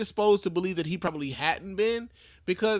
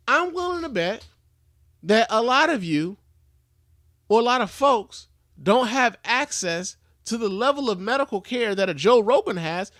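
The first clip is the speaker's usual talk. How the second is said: raised emphatic voice; 5 semitones higher, 5 dB louder than usual. Real, not acted. Ranting about medical access.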